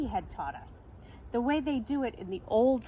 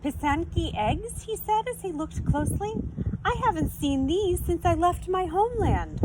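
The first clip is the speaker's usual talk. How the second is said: much higher and louder, full of wonder; amazed; 9 semitones higher, 6 dB louder than usual. A narrator's reading. Shopkeeper recognizing eggs from his homeland, amazed and high. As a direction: marvel at the eggs.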